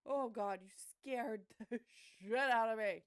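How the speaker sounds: startled scream breaking into laughter; real fright, then laughing it off; scream into giggles. Real, not acted. Startled by a creature in game.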